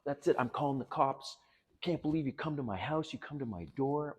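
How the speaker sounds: hint of her furious screaming; mild mock yell. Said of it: "She starts yelling".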